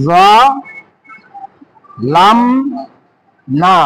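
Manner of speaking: জালাম stretched very long, rising at the end; drawn-out demo. Shown as a demonstration. Teacher stretches the word for students to copy. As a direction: draw জালাম out slowly so pupils hear each sound.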